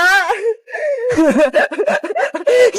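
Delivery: lively, wavering giggle. Acted. Friends teasing Boyong about his cardboard outfit, giggling. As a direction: giggle with swooping pitch.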